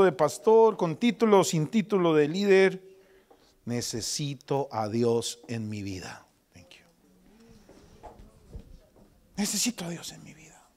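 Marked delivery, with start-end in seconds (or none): 3.7-10.7 whisper. Real, not acted. Quiet confession of need.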